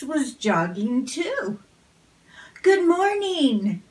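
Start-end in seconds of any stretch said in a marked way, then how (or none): 2.6-3.8 bright, higher friendly hello; cheerful greeting. A narrator's reading. Goose greeting the fox cheerfully, higher and brighter. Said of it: "the goose said cheerfully".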